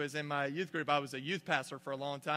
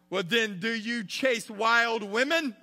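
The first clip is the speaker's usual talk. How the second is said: higher, louder, slow preachy question; hammed-up boy preacher drawing it out; mock preacher; 7 semitones higher, 9 dB louder, slower than usual. Seventh grader preaching at his homeroom class, mock sermon.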